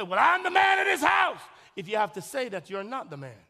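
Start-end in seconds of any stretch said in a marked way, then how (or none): 0.0-1.4 high, loud mock boast of a bossy husband; hammed-up husband demanding respect; domineering husband. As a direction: puff up and bark it like a little dictator. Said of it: "You don't say".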